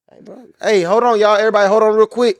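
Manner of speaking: loud call to hold on. Retold or imitated. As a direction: shout to stop the whole set. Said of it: "I'm looking at you like".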